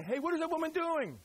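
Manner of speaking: a light put-on bystander voice; imitating a gawking stranger; mock passer-by.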